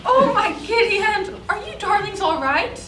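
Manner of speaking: put-on doting elderly woman; old lady voice. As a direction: fuss like a sweet grandma. Old lady neighbor from Jersey.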